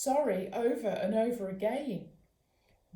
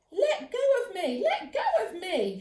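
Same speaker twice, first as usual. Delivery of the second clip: high, loud struggling yell; frightened shout; 10 semitones higher, 6 dB louder than usual. A narrator's reading. Mum shouting at the bag thief. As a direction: yell to shake him off. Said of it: "shouted Mum".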